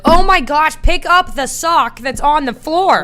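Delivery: loud, high, slow exasperation; fed up with the sock, pleading; exasperated. Retold or imitated. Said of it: "finally you're like".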